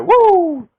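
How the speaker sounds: shrill high loud "woo!" yell; Ric Flair woo. Retold or imitated. Imitating Ric Flair's woo while previewing the title match. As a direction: belt out a wild high woo. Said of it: "Shut up, Nature Boy".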